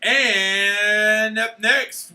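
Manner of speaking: long drawn-out announcer's intro; announcer build-up. Pretend. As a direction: stretch it slowly to build suspense. Announcing award like ring announcer.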